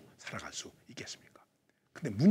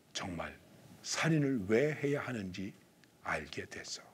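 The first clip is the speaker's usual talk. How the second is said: usual speaking voice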